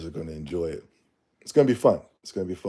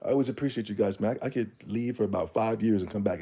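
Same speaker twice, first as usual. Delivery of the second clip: ordinary delivery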